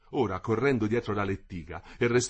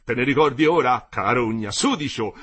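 louder, spat-out scorn; contemptuous insults; 2 semitones higher, 9 dB louder than usual. A narrator's reading. Attackers sneer at the beaten Olindo, angry. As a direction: hurl the insults down at him.

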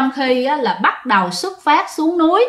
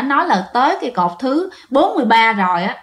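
ordinary delivery

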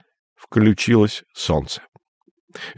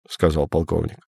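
usual speaking voice

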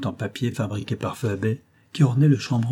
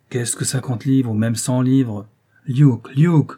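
louder, urgent warning call; remonstrating; 3 semitones higher, 6 dB louder, slower than usual. A narrator's reading. Wife hushing her husband before the guest, louder and urgent. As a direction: cut him off with his name, louder. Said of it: "la remontrance de sa femme".

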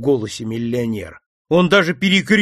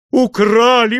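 much higher and louder cry; louder anguished cry; 8 semitones higher, 6 dB louder, slower than usual. Acted. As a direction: wail it out at full force. Frantic merchant's wife bursts in.

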